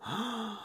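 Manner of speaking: soft, high, sharp intake of breath; startled gasp. Acted. Scrooge meets the silent last ghost, startled soft gasp. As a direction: gasp lightly at a sudden sight.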